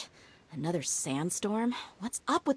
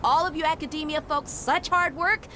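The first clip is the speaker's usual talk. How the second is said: yelling; 8 semitones higher than usual. Acted. Dehya yells at the two to move, impatient yelling. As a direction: hurry them up with a yell. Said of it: "stop yelling".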